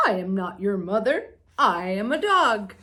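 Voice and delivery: a big dog answering the little bird. Acted. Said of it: "said the dog".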